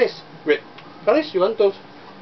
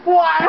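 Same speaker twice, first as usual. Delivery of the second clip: burst of laughter; 13 semitones higher than usual. Real, not acted. Family laughing at the baby.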